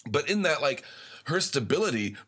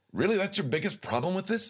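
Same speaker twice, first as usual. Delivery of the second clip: incredulous; 8 semitones higher than usual. Retold or imitated. Friend's reply retold with disbelief.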